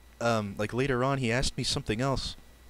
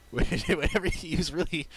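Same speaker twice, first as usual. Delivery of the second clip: higher pitch, breaking into a real laugh; helplessly amused mid-story; laughing while talking; 6 semitones higher than usual. Real, not acted. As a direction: talk through your own giggles. Cracking up retelling the story.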